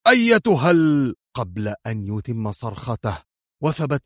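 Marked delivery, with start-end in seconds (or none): 0.1-1.1 higher-pitched cry, cut off abruptly; shouting. A narrator's reading. Gunman turning his weapon on the woman, shouting. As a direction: call out sharply, broken off mid-word. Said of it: "وهو يصرخ".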